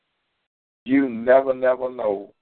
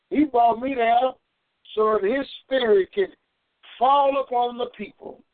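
raised, fervent preaching voice; 11 semitones higher than usual. Acted. Preacher lifting the congregation, fervent higher voice. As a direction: lift it up like the pulpit.

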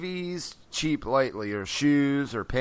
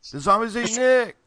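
higher, louder repeat of the clip; mock clip voice; 7 semitones higher, 3 dB louder than usual. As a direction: mimic the clip line. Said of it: "they said it, said".

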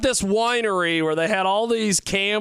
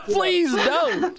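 laughing plea; 12 semitones higher than usual. Retold or imitated. Host quotes colleague, laughing.

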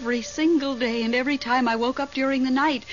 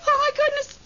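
sharp gasp, then a much higher, startled oh my goodness; startled gasp and cry; 14 semitones higher, slower than usual. Acted. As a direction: jolt up in pitch from sheer shock. Something runs through the room.

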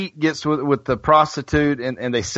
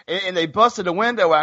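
ordinary delivery

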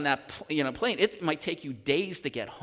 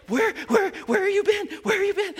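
high, loud frantic calling; frantic lady; 7 dB louder than usual. Retold or imitated. Escort lady who lost him, high frantic calling. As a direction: call out like a flustered escort.

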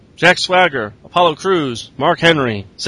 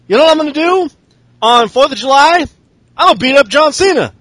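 mock AJ Styles voice; 6 semitones higher than usual. Voicing AJ Styles promo.

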